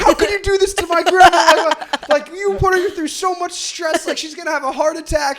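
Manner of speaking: high, loud outraged scolding; mock yelling niece. Niece to driver who hit her grandma, mock yelling. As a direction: imitate a relative yelling in outrage. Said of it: "she starts yelling at me".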